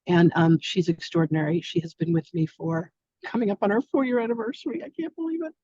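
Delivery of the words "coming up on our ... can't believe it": excited squeal. Real, not acted. Boss marvels at four-year anniversary with colleague, excited squeal. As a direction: squeal it high, holding the volume down. Delighted about a team anniversary.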